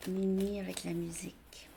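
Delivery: whispered note to herself; chuchoté. Real, not acted. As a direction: murmur it under her breath. Aside to herself.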